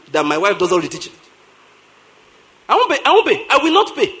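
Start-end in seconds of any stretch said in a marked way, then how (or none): 2.7-4.1 higher, louder insistence on not paying; emphatic refusal. Real, not acted. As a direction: hammer home the refusal. Pastor insists he won't pay.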